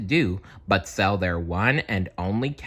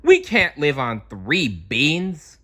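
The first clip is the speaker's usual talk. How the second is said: high, slow, louder complaint; indignant protest; 6 semitones higher, 4 dB louder than usual. Acted. Friends protesting to Mickey about the beans. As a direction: insist it is hopeless.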